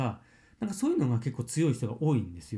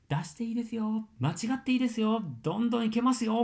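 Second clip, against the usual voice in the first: higher, upbeat coaxing coach voice; encouraging coach; 8 semitones higher than usual. Retold or imitated. Voice trainer urging a shy student to let go, higher and encouraging. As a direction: cheer a timid student on, brightly lifted. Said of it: "みたいな感じで".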